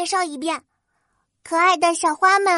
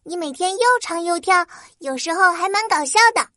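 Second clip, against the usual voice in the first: touched; 4 semitones higher, faster than usual. Acted. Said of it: "花仙子感动极了".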